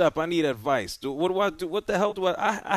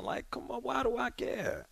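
exasperated; 6 semitones higher, 8 dB quieter than usual. Real, not acted. Host exasperated with caller.

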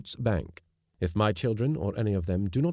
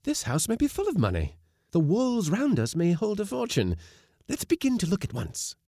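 high and eager, treasure fever; high excited young woman; 12 semitones higher than usual. A narrator's reading. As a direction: she can't wait to start looking. Wife eager to hunt treasure.

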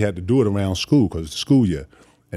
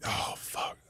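hushed dismay; whispered shock; whispered oh fuck; 14 dB quieter than usual. Retold or imitated. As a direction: whisper it under your breath.

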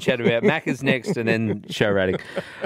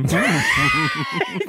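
high burst of laughter. Real, not acted.

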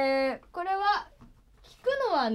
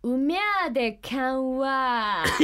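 slow, drawn-out Nagoya dialect with a laugh; Nagoya dialect phrase; 2 semitones lower, much slower than usual. Shown as a demonstration. Teaching the guest a Nagoya dialect phrase, slow and laughing. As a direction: stretch each sound of the Nagoya phrase. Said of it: "使える名古屋弁を白くちゃんに教えとこうか".

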